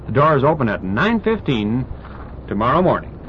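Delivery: ordinary talk, nothing marked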